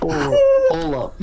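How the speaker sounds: high loud surprised squeak; shocked squeal. Pretend. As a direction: shriek it in shock. Shocked realization.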